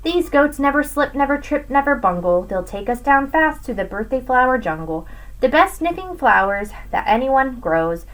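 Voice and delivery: plain reading voice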